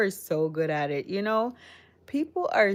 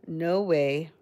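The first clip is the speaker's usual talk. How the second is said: ordinary delivery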